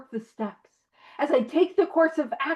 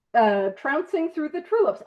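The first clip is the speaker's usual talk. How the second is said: ordinary delivery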